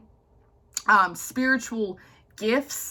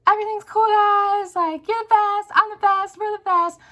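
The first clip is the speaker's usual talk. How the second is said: much higher, louder, bubbly and breezy; sweet high voice; 11 semitones higher, 4 dB louder than usual. Retold or imitated. Her friendly side, voiced much higher and louder. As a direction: gush like everything is perfect. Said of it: "I'm very, like".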